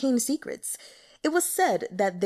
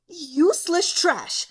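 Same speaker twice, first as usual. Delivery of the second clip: slow, furious sneer, higher and louder; infuriated; 8 semitones higher, 5 dB louder, slower than usual. A narrator's reading. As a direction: spit out the contempt slowly. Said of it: "Mu Zhonglian was infuriated".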